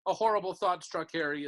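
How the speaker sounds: ordinary delivery, nothing marked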